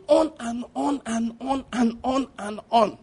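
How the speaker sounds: high, slow, evenly repeated beats; mock endless droning. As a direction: drag it out to mock endless chatter. Mimics her endless talking.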